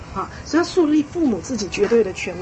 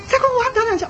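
high mock warning; repeated parental threat; 10 semitones higher, much faster than usual. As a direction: imitate a parent threatening yet again. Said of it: "他说". (模仿家长高声威胁)

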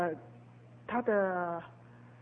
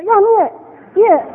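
high, loud insisting; insistent command; 9 semitones higher, 11 dB louder, slower than usual. Retold or imitated. Preacher insisting the nephew read, high and loud. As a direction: demand it, no arguing.

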